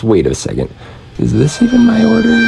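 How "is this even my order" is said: mock alarm. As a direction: pretend shock, voice jumping up. Fake alarm about the order.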